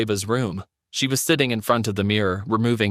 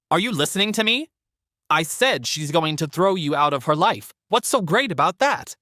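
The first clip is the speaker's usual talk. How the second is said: high, exasperated mother-in-law; indignant older woman; 9 semitones higher than usual. Acted. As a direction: demand his attention, indignant. Sandra indignant.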